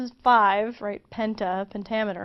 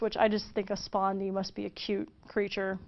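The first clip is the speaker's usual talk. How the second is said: ordinary delivery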